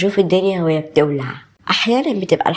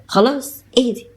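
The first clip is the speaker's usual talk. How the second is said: usual speaking voice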